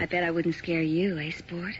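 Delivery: normal talking voice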